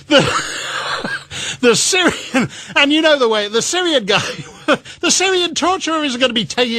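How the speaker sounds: can barely get the words out for laughing. Real, not acted. Cracking up at his own joke.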